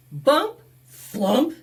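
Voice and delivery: usual speaking voice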